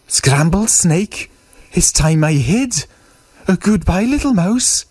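high, startled snake voice; alarmed snake. Acted. Snake takes fright and says goodbye to mouse, alarmed high voice. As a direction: snake making a nervous, high-pitched exit.

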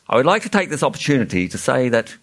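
ordinary delivery, nothing marked

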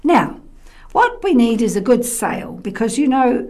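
bossy pirate. Acted. Ebony giving orders loudly.

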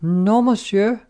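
put-on high, drawn-out boy's voice; polite young waiter, pitched high; smiling waiting boy. A narrator's reading. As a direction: sing it out slowly and high with a smile. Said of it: "said the boy, who never stopped smiling".

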